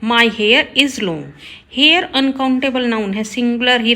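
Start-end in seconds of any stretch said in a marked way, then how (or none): 0.0-1.2 slow, careful read of the corrected sentence; slow teacher dictation. Shown as a demonstration. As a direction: lay out each word clearly for the class. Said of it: "right होगा".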